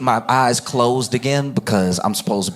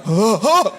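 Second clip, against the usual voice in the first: gasping huh; 8 semitones higher than usual. Retold or imitated. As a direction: gasp in shock at a foot in chicken. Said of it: "We'd all be like".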